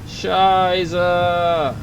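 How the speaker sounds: long, stretched-out exclamation of dismay; drawn-out curse. Real, not acted. Player cursing as the craft starts dropping, drawn-out curse. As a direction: draw the swear out on a held note.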